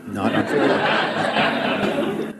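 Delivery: slow, laughing emphasis; slowed amused insistence. Real, not acted. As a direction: savour the absurd rule.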